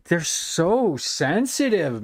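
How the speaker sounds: they're so sensitive, with so drawn long and falling; dragged out in disbelief; drawn-out scorn. Real, not acted. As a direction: stretch out so sensitive, very slow. Mocking the sensitive streamers.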